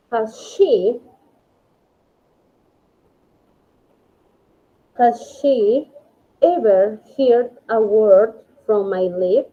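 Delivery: slow English reading, rising at the end; slow model reading. Shown as a demonstration. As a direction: read it slowly as a question. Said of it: "Luego dice". (lectura lenta y marcada de la pregunta en inglés)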